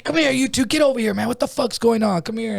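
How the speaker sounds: high-pitched retold guard's call; guards' stern order. Guard to two fighting inmates, indignant command. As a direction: imitate a guard breaking up a fight.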